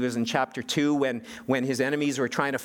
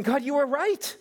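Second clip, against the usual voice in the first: much higher, pleading confession; Daniel pleading with God, much higher and impassioned; impassioned prayer. Retold or imitated. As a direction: cry out to God, owning the blame. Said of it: "Daniel is saying".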